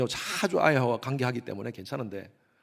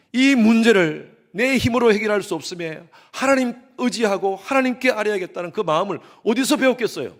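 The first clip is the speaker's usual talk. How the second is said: raised and louder, urging; fervent preaching; 6 semitones higher, 7 dB louder than usual. Real, not acted. Preacher urging the congregation, raised and louder. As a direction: press the question on the congregation.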